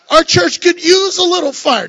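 real laughter breaking into the joke; laughing. Real, not acted. Preacher joking to his congregation, laughing.

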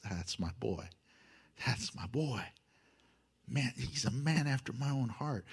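बहुत नरम, धीमी और प्यार भरी आवाज़ में भगवान की तरह; tender fatherly God. Retold or imitated. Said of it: "And God goes".

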